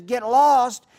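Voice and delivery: loud, high disbelieving "Get lost?"; incredulous echo. Acted. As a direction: toss the question back in disbelief. Cat owner incredulous at the question.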